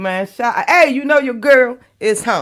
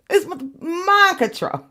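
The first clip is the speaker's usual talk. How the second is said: laughing; 7 semitones higher, slower than usual. Real, not acted. Laughing aside.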